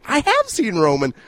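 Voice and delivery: retold indignant stammer; flustered protest. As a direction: defend yourself, high and emphatic.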